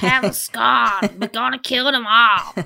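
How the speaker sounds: mock Don Corleone threatening over scones; mock Italian mobster. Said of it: "Don Corleone Italian accent".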